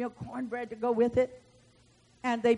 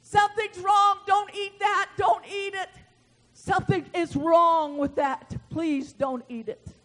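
high, loud alarmed cry easing into a plea; 7 semitones higher, 5 dB louder than usual. Acted. Men eating the stew warn the man of God, crying out. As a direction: cry a warning, pitched up, then plead. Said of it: "they cried out and said".

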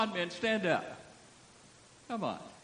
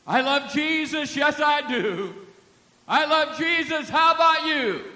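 loud proclamation; 9 semitones higher, 9 dB louder than usual. Retold or imitated. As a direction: call it out over the crowd. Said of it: "louder and louder".

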